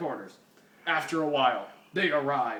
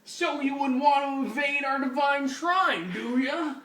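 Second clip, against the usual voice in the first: put-on taunting old hippie; 11 semitones higher, 6 dB louder than usual.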